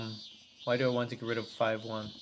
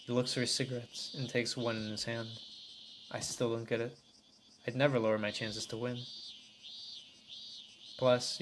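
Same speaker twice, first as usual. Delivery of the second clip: plain reading voice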